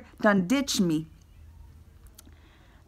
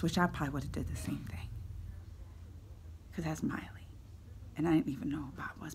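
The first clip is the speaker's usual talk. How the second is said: fast, hushed confiding whisper; whispered aside; 10 semitones lower, 12 dB quieter, faster than usual. Real, not acted. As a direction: let the audience in on a secret, quickly.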